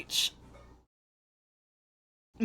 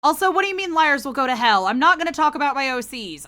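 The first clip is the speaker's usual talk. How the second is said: fast, loud and high protest; playful indignant burst, fast and loud; 6 semitones higher, 7 dB louder, faster than usual. Real, not acted. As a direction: rattle it off loud in mock outrage.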